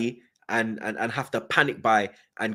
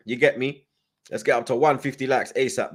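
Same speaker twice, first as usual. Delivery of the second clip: normal talking voice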